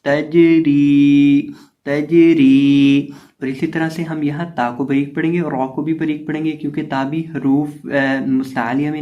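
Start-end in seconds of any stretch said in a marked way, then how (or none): 0.0-3.1 तजरी with the जीम bounced and drawn out; slow tajweed recitation. Shown as a demonstration. Teacher recites तजरी slowly so students hear the qalqala. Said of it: "तो हम इसे पढ़ेंगे".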